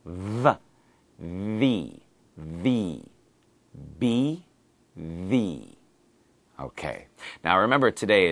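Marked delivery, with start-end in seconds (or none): 0.0-3.0 V buzzed with top teeth on the bottom lip; V sound. Shown as a demonstration. Teacher demonstrating V against B for learners. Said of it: "You have your teeth touch your bottom lip". 4.0-5.8 B popped from closed lips, then V buzzed on the lip; B versus V. Shown as a demonstration. Teacher contrasting the two sounds side by side. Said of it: "Now the V sound is different".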